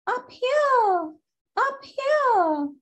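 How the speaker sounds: high squeaky call from above; small squeaky voice. A narrator's reading. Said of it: "they hear the voice say again".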